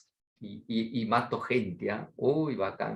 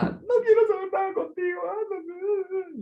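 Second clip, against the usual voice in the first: high, far wailing voice, fake crying daughter; mock sobbing daughter; 3 dB louder than usual. Sulking daughter wails at father, mock sobbing high. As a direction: sob it out like a sulking teen, very high.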